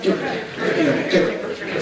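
"Tik" with the tongue curled back; retroflex Tik. Shown as a demonstration. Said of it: "But do it with a retroflex tongue".

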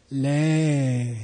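long drawn-out 'Le'; longest length. Shown as a demonstration. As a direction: stretch the vowel of 'Le' right out. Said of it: "three different lengths of it".

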